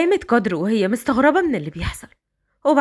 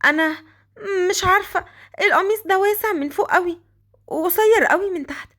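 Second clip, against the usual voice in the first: put-on higher, young girl's voice; Qadr, high girlish voice; 7 semitones higher than usual. The girl complaining to Raad his clothes don't fit, high girlish voice.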